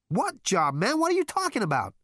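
loud, high-pitched questioning; exasperated. A narrator's reading. Zaphod demands to know what job, exasperated. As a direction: voice shoots up in frustration.

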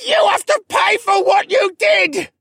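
high, very loud hoarse shriek; agonized screech. Acted. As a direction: shriek it with everything left. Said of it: "he screeched".

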